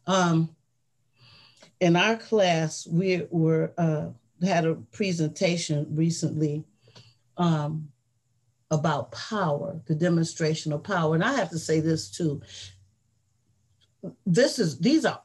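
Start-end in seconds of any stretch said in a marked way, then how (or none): none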